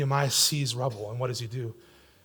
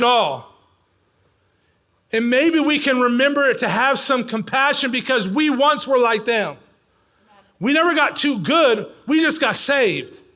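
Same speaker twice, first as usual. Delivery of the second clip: loud, high-pitched sermon delivery; impassioned preaching; 6 semitones higher, 5 dB louder than usual. Real, not acted. Preacher urges church to remember grace and compassion, impassioned. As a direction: drive each phrase home to the congregation.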